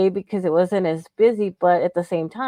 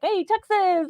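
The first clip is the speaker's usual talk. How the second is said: imitating patient's greeting; 11 semitones higher, slower than usual. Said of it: "like that".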